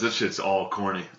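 ordinary talk, nothing marked

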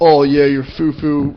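slow, drawn-out ribbing; mock teasing. Friend ribbing him over fancy coffee, mock teasing. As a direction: tease him slowly as an imagined friend.

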